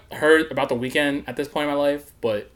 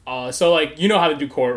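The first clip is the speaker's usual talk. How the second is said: ordinary delivery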